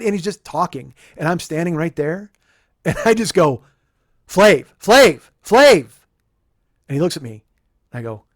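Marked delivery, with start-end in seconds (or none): 4.3-5.9 high, loud repeated call. Retold or imitated. Fan calling to rapper in the hallway, loud high shout. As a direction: shout the name to get his attention. Calling to get [name]'s attention.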